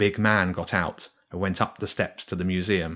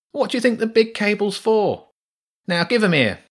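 much higher, demanding rat; impatient rat; 12 semitones higher, 5 dB louder than usual. Acted. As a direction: grab the cables now.

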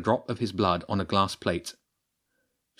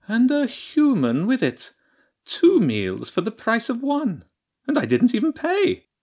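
high, sly mocking badger voice; a scientist badger gloating over her dinner; badger priestess; 5 dB louder than usual. The badger priestess teasing James, high and mocking.

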